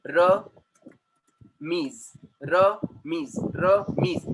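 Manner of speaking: "Ramiz" as Ra, pause, miz; split into syllables. Shown as a demonstration. Teacher showing what a syllable is, "Ramiz" split Ra - miz. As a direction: break "Ramiz" into two separate beats. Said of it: "This is what a syllable".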